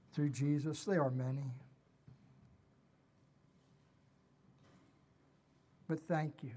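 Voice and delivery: earnest, measured prayer to the Father; closing prayer. Acted. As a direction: pray it sincerely, leading the congregation.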